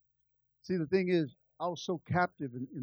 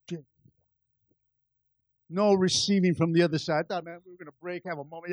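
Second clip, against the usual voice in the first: normal talking voice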